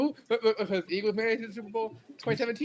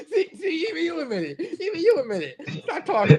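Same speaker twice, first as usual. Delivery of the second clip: laughing through the words, pitched up; 10 semitones higher than usual. Real, not acted. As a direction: crack up while teasing him. Laughing at friend's contradiction.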